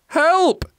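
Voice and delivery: put-on very high child's cry; boy's yell. A narrator's reading. Jack runs from the troll, high yell. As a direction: yell for help as a small boy. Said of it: "yelled Jack".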